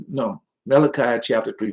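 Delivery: ordinary talk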